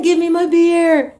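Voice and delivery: put-on high, whiny drunk plea; drunk demand. Drunk demand while spun around.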